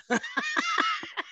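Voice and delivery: squeaky cackle; high-pitched laugh. Real, not acted. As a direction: burst into a high giggle. High laugh at fishing story.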